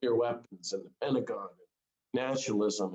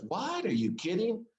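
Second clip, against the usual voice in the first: high squeaky incredulous What; mock disbelief; 6 semitones higher than usual. Preacher voicing the crowd's stunned disbelief, high. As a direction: imitate a baffled crowd hearing the sermon. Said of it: "everybody goes".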